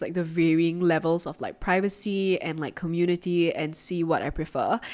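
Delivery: ordinary talk, nothing marked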